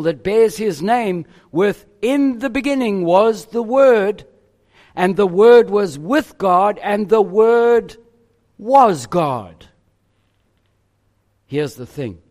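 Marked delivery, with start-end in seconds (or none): 2.0-9.7 proclaimed scripture. Acted. Preacher quotes John's gospel.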